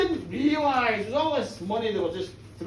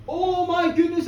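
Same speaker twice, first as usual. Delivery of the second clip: 1 alarmed cry; 7 semitones higher, 6 dB louder than usual. Acted. Mike and Molly alarmed by ruin.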